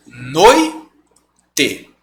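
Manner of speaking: Noite as "noi... te", oi kept together; slow Noite in two separate beats; syllabified pronunciation. Shown as a demonstration. Teacher showing how it is pronounced, Noite as noi... te. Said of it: "como que é a pronúncia?".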